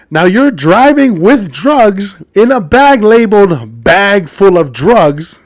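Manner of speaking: slow, punched "driving with drugs in a bag labeled bag full of drugs"; incredulous emphasis. Acted. Podcaster lecturing a criminal, each word of "bag full of drugs" stressed slowly.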